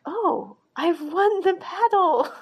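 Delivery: high, loud, gloating crow of victory; gleeful triumph. Retold or imitated. Man crowing to Type that he won, in high loud glee. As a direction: crow it like you just won the fight.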